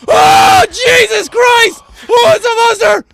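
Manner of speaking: high panicked shout; scared horror game streamer. Real, not acted. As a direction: cry out in fright. Monster appears.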